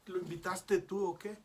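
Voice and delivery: a retold, irritated apostle protesting to the Lord; annoyed Peter. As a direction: play Peter, put out and jealous.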